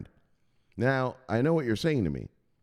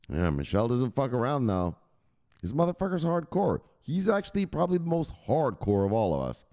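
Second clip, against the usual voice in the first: normal talking voice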